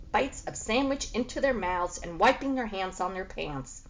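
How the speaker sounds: usual speaking voice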